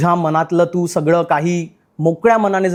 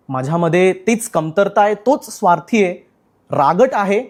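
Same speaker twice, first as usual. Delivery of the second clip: normal talking voice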